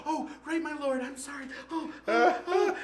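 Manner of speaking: high-pitched, hammed-up girlish apology; flustered apology. Girl apologizes to the emperor after slipping, flustered. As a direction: squeal sorry, dissolving into silly whimpers.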